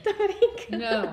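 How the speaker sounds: slow, laughing echo. Real, not acted. Friend echoing the joke back, laughing slowly. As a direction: repeat it slowly through a laugh.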